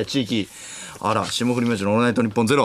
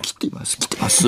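hushed stage-magician impression; ミスターマリック; 4 dB quieter than usual. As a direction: conjure it under the breath, stretching the end. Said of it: "ミスターマリック。". (ミスターマリック風にささやくように)

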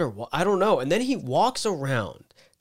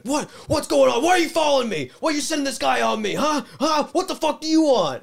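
high-pitched furious yelling, much louder; retold freakout; 14 semitones higher, 6 dB louder than usual. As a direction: paranoid customer yelling accusations. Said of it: "the guy starts flipping the fuck out".

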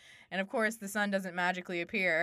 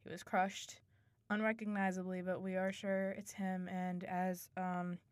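soft, level acted condolence; somber condolences; 9 dB quieter than usual. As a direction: gently break bad news, much softer. Voicing bearer of bad news.

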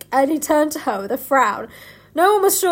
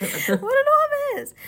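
squeaky mock voice; 11 semitones higher, slower than usual. Squeaky mock cry mid-read.

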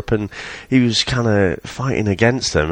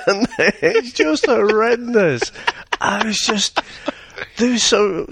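high, slow, real laughter through the words; barely getting it out for laughing; 12 semitones higher, slower than usual. Real, not acted. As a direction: crack up while condemning it. Helpless laughter at the accent.

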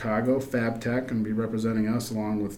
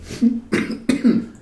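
chesty cough; 12 semitones higher, 5 dB louder than usual. Real, not acted. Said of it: "Just broke something loose there".

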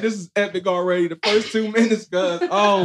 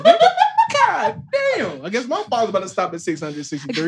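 laughing; 8 semitones higher, 5 dB louder than usual. Real, not acted. Laughing at the reveal.

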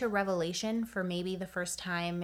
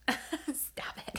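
breathless giggly protest; laughing; 7 semitones higher, 6 dB quieter than usual. Real, not acted. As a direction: laugh through the words. Laughing at co-host's joke.

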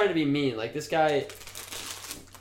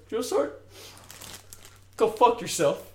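mock earnest recruit; 8 semitones higher than usual. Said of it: "he goes".

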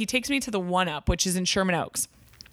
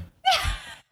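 sudden high squeal of a laugh; high burst of laughter. Real, not acted. Laughs at no British guy.

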